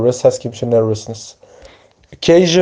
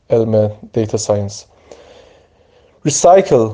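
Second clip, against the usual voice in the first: usual speaking voice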